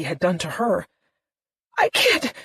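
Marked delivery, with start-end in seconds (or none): 1.8-2.3 much higher and louder; a breaking cry; girl panicking, much higher; panicked. A narrator's reading.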